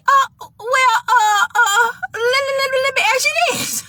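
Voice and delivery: high, stuttering hesitation; flustered stammer. Retold or imitated. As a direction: stumble and stutter into the question. Flustered, stammers before big question.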